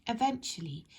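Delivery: plain reading voice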